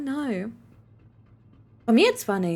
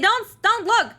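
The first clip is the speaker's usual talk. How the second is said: much higher and louder don't look; high squeaky plea; 12 semitones higher, 5 dB louder, slower than usual. Acted. Caught changing, squeaking at viewers not to look. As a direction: squeak it out in alarm.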